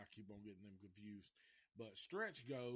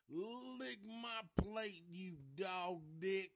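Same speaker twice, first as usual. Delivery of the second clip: high, loud and slow drawn-out sneer; imitating movie insult; 6 semitones higher, 5 dB louder, slower than usual. L G insults Chop Top, high loud slow sneer. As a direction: savour the insult like a cartoon villain. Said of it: "my favorite line out of the movie".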